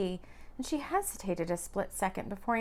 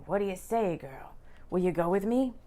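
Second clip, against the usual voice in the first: sexy; 2 semitones lower than usual. A narrator's reading. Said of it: "Sounding sexy again".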